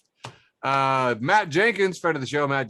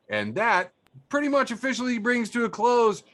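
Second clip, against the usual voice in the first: show sign-off; 7 semitones higher than usual. Acted.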